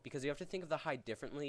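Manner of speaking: ordinary delivery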